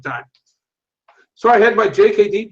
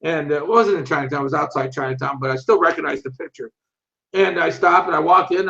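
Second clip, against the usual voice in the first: normal talking voice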